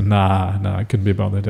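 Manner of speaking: low, soft Aussie brush-off; Australian lingo. Acted. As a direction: lazily decline in light Australian lingo. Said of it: "use the Australian lingo".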